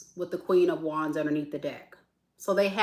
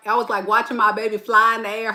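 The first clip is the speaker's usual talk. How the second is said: usual speaking voice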